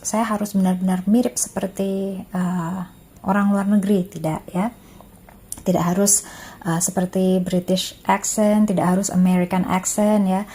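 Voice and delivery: ordinary talk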